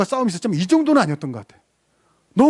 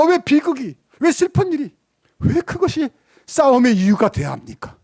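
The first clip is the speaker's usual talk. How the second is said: ordinary talk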